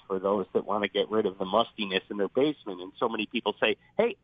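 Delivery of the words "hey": usual speaking voice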